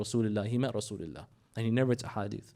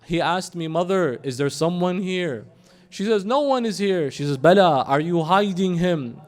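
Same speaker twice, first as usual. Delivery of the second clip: raised, urgent storytelling at higher pitch; impassioned dramatic narration; 5 semitones higher, 6 dB louder than usual. A narrator's reading. Preacher retells mother pleading with her son, impassioned. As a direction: press the mother's plea, loud and high.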